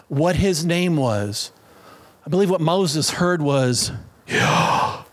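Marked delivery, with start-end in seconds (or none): 4.3-5.0 Yahweh as pure breath, inhale-exhale; Yahweh whispered like slow breathing; breathing sound. Shown as a demonstration. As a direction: breathe "Yahweh" as two long voiceless puffs, YAH-WAH. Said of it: "The breath of God".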